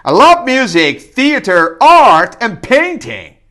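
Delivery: loud, high, excited listing; energetic. Real, not acted. Learner showing energy to the camera, excited. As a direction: pump the list out with energy. Energetic English repetition practice.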